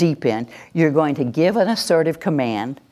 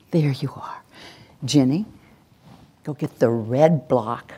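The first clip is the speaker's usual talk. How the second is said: whisper; 5 semitones lower than usual. Shown as a demonstration. Parent greeting the child up close in a whisper. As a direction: breathe it gently right beside her. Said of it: "That's when you say".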